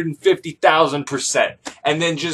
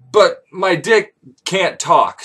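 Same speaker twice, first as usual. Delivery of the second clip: usual speaking voice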